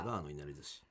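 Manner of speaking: low, quiet echo of the name; deadpan quiet echo. Real, not acted. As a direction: repeat it flatly under your breath. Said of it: "ババアのいなり寿司からいただきました".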